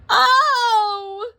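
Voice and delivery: high, loud startled gasp; startled cry falling off a bike. A narrator's reading. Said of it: "she gasped as the bicycle tipped".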